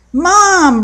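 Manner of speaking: high called-out Mom; shouted. A narrator's reading. Boy calling his mother to tattle, shouting. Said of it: "Russell shouted".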